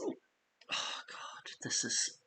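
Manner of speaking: exasperated breathy whisper. Real, not acted. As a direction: whisper it to yourself, fed up. Frustrated hiccup aside.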